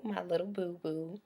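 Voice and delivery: soft, cooing pet-name voice. Real, not acted. Owner cooing a pet name for her dog, softly. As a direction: coo it like you're talking to a puppy.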